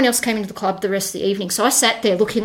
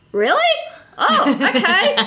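surprised, laughing; 11 semitones higher, slower than usual. Retold or imitated. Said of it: "who is it?".

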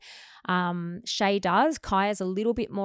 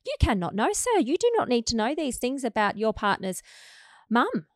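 high excited protest; 5 semitones higher, 5 dB louder than usual. Retold or imitated. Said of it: "And I'm like".

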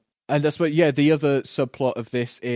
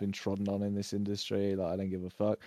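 mock indifference; 4 semitones lower, 9 dB quieter than usual. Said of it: "just like".

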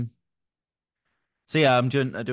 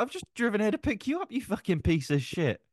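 an exasperated retort, much higher; retold rant; 11 semitones higher than usual. Friend retelling how he ranted at his ungrateful mate, exasperated mock rant. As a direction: play up the indignant driver, mock fury. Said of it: "I was like".

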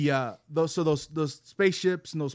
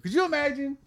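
excited disbelief; 12 semitones higher, 4 dB louder than usual. Real, not acted. Marveling at astronaut's danger.

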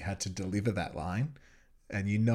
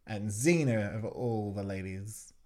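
ordinary delivery

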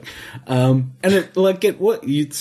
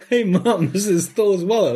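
higher-pitched retold excitement; excited kid; 7 semitones higher than usual. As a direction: imitate a kid calling mom over, astonished. Kid excitedly telling his mom.

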